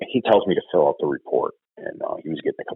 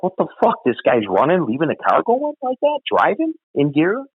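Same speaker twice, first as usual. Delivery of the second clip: shocked disbelief; 12 semitones higher than usual. Retold or imitated. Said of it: "I'm like".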